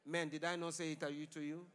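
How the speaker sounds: ordinary talk, nothing marked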